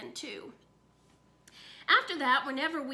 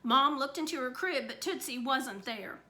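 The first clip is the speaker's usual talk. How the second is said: ordinary delivery, nothing marked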